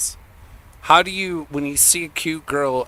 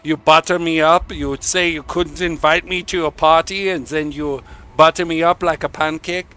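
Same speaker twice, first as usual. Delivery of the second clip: loud, higher mock-Swedish indignation; bad Swedish accent; 4 semitones higher, 6 dB louder than usual. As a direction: protest in a hammed-up Swedish accent. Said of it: "I can't do the Swedish accent".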